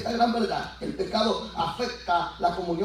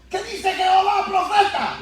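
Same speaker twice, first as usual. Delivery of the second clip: high-pitched pleading question; Saúl preguntando; 11 semitones higher, 4 dB louder than usual. Acted. Saul anxiously questioning the prophet. (pregunta en tono muy agudo)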